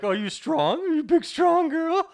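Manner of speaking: put-on very high, loud baby voice; gushing pet talk. Owner gushing over a cat, high baby talk. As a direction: coo way up high, stretching the words. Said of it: "I like kissing him, like".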